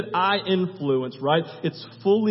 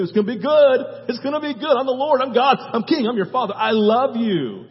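higher, emphatic, exultant promises; God, excited; 3 semitones higher, 4 dB louder than usual. Acted. God as Father to his people, acted excited reassurance. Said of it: "yes, I'm excited".

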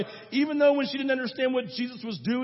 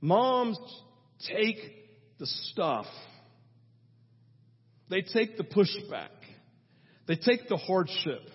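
usual speaking voice

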